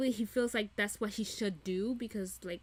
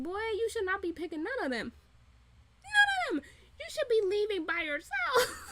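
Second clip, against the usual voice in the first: much higher, pleading and almost crying; a fan yelling advice at Louis, near tears with frustration; exasperated scolding; 10 semitones higher than usual. Retold or imitated. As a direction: beg him to walk away, voice cracking. Scolding the character, voice cracking.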